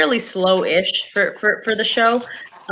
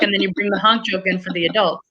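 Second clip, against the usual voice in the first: ordinary talk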